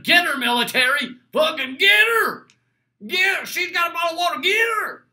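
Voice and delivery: hammed-up Southern yell, high and loud; shouted Southern accent. Mock order to the military to chase a migrant, Southern accent shout. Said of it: "that Southern accent really takes it out".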